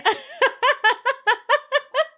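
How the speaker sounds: long, high peal of laughter. Real, not acted. Streamer cracking up at chat's message, long high laugh.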